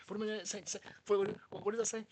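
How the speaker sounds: high, loud, flustered stammer; acted panic. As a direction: panic and trip over the words. Acting out his panic at sergeants.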